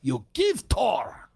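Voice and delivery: slow, demanding mock Russian accent; insistent broken-English Russian accent. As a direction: imitate a pushy Russian tourist, Russian accent. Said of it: "She goes".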